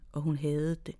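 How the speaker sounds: ordinary delivery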